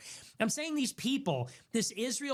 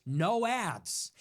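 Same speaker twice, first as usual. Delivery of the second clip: normal talking voice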